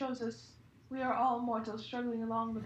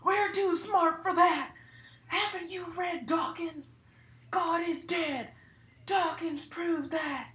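mock atheist rant; 4 semitones higher, 4 dB louder than usual. Hagrid mocking an atheist aunt.

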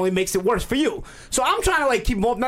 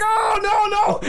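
alarmed shout; 12 semitones higher, much slower than usual. Retold or imitated. Brother-in-law stops the fight.

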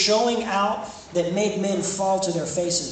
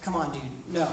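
casual brush-off; 4 semitones lower, 7 dB quieter than usual. Acted. Jesus dismissing Peter casually.

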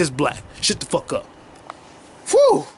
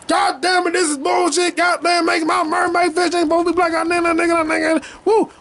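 mock outrage; 9 semitones higher than usual. Ranting at the audience in mock outrage.

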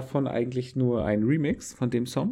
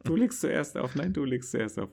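higher, teasing lovestruck voice; coy you-hang-up-first banter; late night call with crush; 6 semitones higher than usual. Pretend. Mock couple hanging up.

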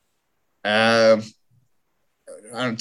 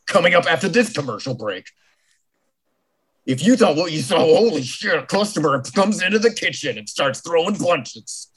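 mock trailer voiceover; 4 semitones higher than usual.

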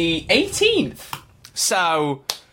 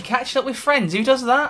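mock scorn; 8 semitones higher than usual. Mock scoffing at boring plans.

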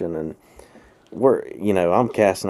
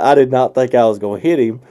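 ordinary talk

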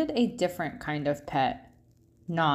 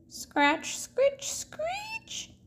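rising squeaky scratch sounds; spooky window scratching; scratchy noises; 4 dB quieter, much slower than usual. Acted. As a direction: climb higher on each noise.

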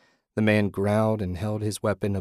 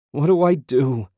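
high, loud cry of despair; desperate, raised-voice plea; 6 semitones higher, 6 dB louder than usual. A narrator's reading. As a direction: cry out helplessly. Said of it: "Ted cried".

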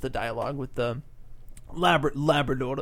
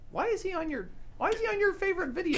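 high-pitched, softer squeal of disbelief; incredulous; 7 dB quieter, faster than usual. Real, not acted. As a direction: question your friend in baffled high pitch. Teasing disbelief at friend's video.

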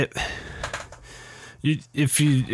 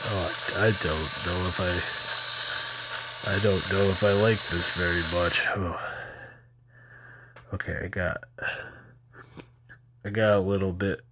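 uncomfortable groaning; 6 dB quieter than usual. Real, not acted. Sinus rinse running, discomfort.

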